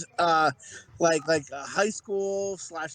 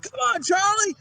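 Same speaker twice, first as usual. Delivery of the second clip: higher-pitched imitation of a rallying cry; urging shout; 11 semitones higher, 4 dB louder than usual. Retelling Billy the Kid's line to friends, urging shout. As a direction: call him on, pitched way up.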